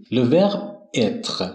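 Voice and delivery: Le verbe être stretched out word by word; very slow model pronunciation. Shown as a demonstration. Teacher naming the verb être, very slow French model. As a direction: spell Le verbe être out very slowly.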